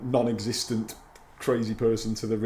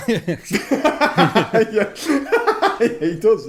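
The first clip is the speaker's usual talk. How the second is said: high giggly laugh through the words; laughing at the murder reveal; 10 semitones higher, 6 dB louder, much slower than usual. Real, not acted. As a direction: laugh it out, amused.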